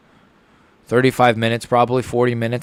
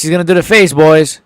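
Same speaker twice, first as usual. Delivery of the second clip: excited; 5 semitones higher, 7 dB louder than usual. Real, not acted. Hyped fight watching.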